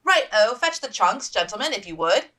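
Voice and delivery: brisk matron giving orders; bossy order. Acted. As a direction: order the men about cheerily. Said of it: "the woman ordered".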